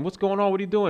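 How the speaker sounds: retold high, puzzled questions; Meg Ryan. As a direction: imitate someone asking urgently what is wrong. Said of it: "Meg Ryan's asking".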